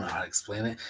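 drops to a whisper, half to himself; whispered aside. Real, not acted. Fan trailing off about the show, whispering. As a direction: whisper it like you're giving up. Hard to put into words.

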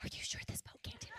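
fast, breathy half-laughed whisper; laughing whisper. Retold or imitated. Mom asking the husband on the storm boat, nervous laughing whisper. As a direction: sneak the question out in a giggly whisper.

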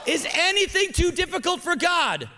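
shouted high and loud like a call to answer; preached shout. Shown as a demonstration. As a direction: belt it with full conviction. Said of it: "Say it again".

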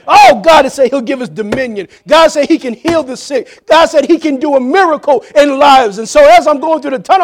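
shouted, high-pitched sermon climax; preach. Real, not acted. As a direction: build fervently, shouting each promise. Preaches at full force.

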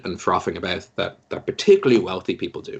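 ordinary talk, nothing marked

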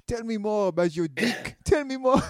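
high, cooing mock French voice; French accent. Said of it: "My family's French. I'm allowed to do that".

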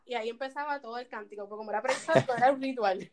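ordinary talk

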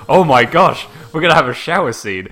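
acted giddy anticipation; mock excitement. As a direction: gush it like an eager fan. Acting out player's thrilled anticipation.

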